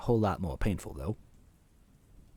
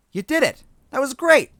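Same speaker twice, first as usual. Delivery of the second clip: higher, louder bubbly friend; cheerful girl voice; 10 dB louder than usual. Acted. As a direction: cheer him on brightly. Goombella's cheerful voice.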